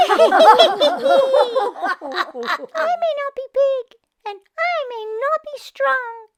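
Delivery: high squeaky little bat; high, tiny bat voice; small bat voice. Acted. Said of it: "said the bat".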